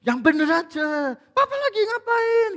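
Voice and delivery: high-pitched mock scolding, rising to a yell; angry father. Annoyed papa scolding his child mid-work, mock angry yell. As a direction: act out an exasperated parent telling off a child. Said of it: "Biasanya marah toh ya?".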